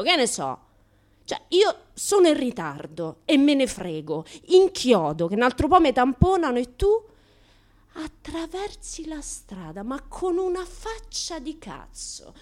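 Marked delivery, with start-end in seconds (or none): 1.2-6.9 louder, fired-up complaint; loud annoyed retelling; exasperated rant. Retold or imitated. Angry retelling of braking hard. 7.9-12.3 higher, slow, snooty mimicry; mocking a snooty passer-by; mock haughty. Mocking the haughty woman crossing.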